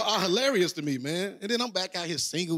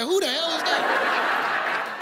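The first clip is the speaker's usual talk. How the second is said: high, drawn-out mock disbelief; baffled disbelief; 10 semitones higher, much slower than usual. Comic telling his uncle he never heard of him, stunned. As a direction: stretch it out like you can't believe the name. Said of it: "I'm like".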